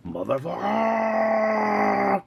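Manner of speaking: high, slow, stretched-out yell; drawn-out battle yell. Pretend. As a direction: let it rip as a charging hero. Toy hero yells in fight.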